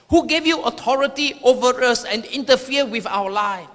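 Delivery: normal talking voice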